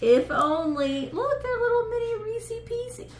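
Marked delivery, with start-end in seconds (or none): 1.1-3.1 cute high voice. Real, not acted. Cooing over tiny candies.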